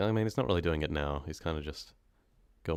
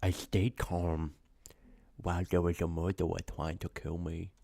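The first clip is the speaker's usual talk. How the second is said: fake American accent; 3 dB quieter than usual. Shown as a demonstration. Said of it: "This sounds weird".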